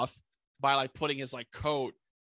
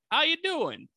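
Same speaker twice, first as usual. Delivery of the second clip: high-pitched mock New York cop greeting; New York accent; 12 semitones higher, slower than usual. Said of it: "I keep doing a New York accent".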